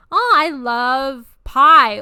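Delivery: loud, drawn-out mock delight; hammed-up gushing. Casually saying love about food to a guy, hammed-up gushing. Example of unrelated use of love.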